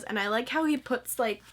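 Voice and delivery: usual speaking voice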